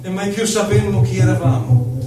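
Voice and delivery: ordinary delivery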